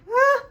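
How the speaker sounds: short high startled "ah"; sudden high-pitched yelp. Real, not acted. Vlogger whose camera is acting up, startled yelp. Camera slipping while recording.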